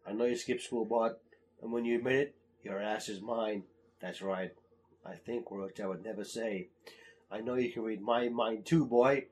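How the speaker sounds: quietly threatening, like a stern father; low menacing dad. Retold or imitated. Quoting Homer to Bart.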